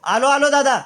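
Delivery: high, hurried-to-answer call to an elder brother; calling back. Acted. Calls back to his elder brother. (चढ्या आवाजात ओ देणे)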